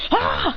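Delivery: high-pitched battle yell; power-up cry. Acted. Fighter activating Ultra Instinct, high power-up yell.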